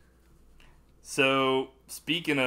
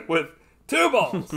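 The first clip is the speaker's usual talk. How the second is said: laughing; 12 semitones higher, 11 dB louder, slower than usual. Real, not acted. Laughing at the rocket joke.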